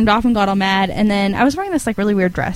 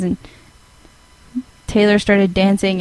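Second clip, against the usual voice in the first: normal talking voice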